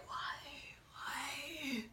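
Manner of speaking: very soft, breathy 'why'; a barely voiced, despairing whisper; faint dismayed whine. Reader voicing a character's dismay, faint whimper. Acting out drawn-out dismayed why.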